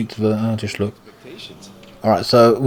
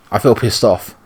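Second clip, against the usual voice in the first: ordinary delivery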